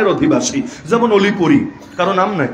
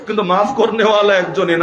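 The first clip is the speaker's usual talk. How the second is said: ordinary talk, nothing marked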